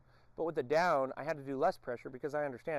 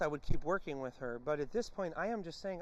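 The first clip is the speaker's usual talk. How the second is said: ordinary delivery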